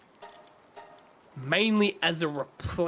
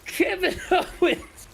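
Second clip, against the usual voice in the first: high, laughing shout of "Kevin Owens"; a hammed-up, laughing cheer; mock crowd cheer; 12 semitones higher than usual. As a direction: play the giddy fan shouting his name and cracking up. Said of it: "and all of a sudden they go".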